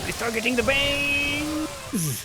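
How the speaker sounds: high, stretched caster's cry; real excited high-pitched call; excited commentary shout. Real, not acted. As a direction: call the play, last word held long and high. Caster calls out exciting play.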